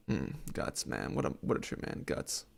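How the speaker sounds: breathy whisper to himself; whispered musing. Real, not acted. Reader mulls over "guts" in a near whisper. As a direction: whisper it as if savouring the word.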